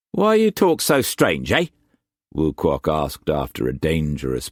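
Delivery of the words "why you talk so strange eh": Cockney swagger, pitched well up and pushed; harsh Cockney accent. A narrator's reading. Wu Kwok pressing food on Struan, in harsh Cockney accent. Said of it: "You don't talk like me".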